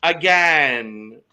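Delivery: loud, drawn-out "again"; emphatic punchline. Pretend. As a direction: land it big and stretched. Hammed punchline about her age.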